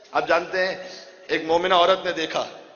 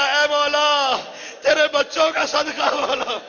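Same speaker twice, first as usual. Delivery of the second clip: anguished plea; 8 semitones higher, slower than usual. Retold or imitated. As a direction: beg the Lord in agony, stretching the words. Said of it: "ماں نے تڑپ کر کہا".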